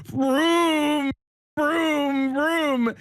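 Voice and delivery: put-on high, slow childlike vroom vroom; toy car vroom. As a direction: drag out each vroom like a kid playing with a toy car. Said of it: "Paige picks up a car ... starts going vroom".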